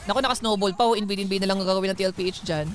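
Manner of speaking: normal talking voice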